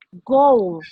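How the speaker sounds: Goal with a strong, pronounced G; stronger G. Shown as a demonstration. Teacher modeling a strong English G for students. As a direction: punch the G in Goal hard. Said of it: "más pronunciado, más fuerte".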